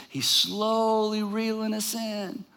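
slow, softened, drawn-out delivery. Shown as a demonstration. Preacher showing how James reels us in, slowly drawn out. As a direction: stretch SLOWLY out long and soft.

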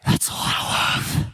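slow, voiceless whisper; breathless awe. Acted. Leader after catching God's love, slow awed whisper. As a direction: whisper it as if overcome. Acts spent, breathless wonder.

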